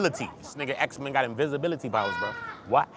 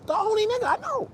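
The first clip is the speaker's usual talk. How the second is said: squeaky aside. Real, not acted. High squeaky emphatic aside.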